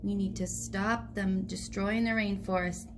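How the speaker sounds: ordinary delivery, nothing marked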